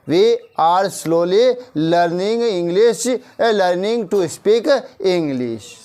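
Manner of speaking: very slow, each word spaced out for learners; slow dictation. Shown as a demonstration. Teacher to Hindi-speaking class, slow clear English model sentence. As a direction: spell it out slowly so the class can follow. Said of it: "समझ गए ना आप लोग?".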